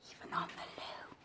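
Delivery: conspiratorial whisper; mock secretive whisper. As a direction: hush it as a cheeky secret. Comic final item in list.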